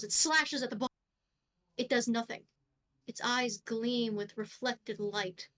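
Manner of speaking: ordinary talk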